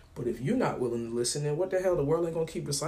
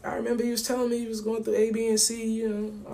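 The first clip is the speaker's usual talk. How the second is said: friend's voice, high; 8 semitones higher, 4 dB louder than usual. Retold or imitated. Friend checking in on the phone, high voice. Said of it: "He goes".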